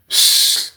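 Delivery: long hushing hiss; shushing. Retold or imitated. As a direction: imitate shushing someone. Retold shushing.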